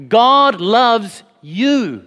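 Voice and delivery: put-on slow, gentle assurance to a child; tender and sincere. Father telling his children God loves them, tender and slow. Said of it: "say with a clear conscience".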